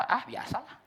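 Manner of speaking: high careless brush-off; mock shrug. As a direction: toss it off lightly. Shrugging congregant imitated.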